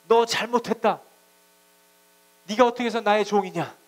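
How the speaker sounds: loud, high-pitched scolding in God's role; mock divine rebuke. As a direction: thunder an accusing rebuke, as an imitation. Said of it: "이렇게 막 책망한 게 아니라".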